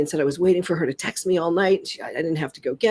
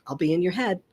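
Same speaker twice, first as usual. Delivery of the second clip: ordinary talk, nothing marked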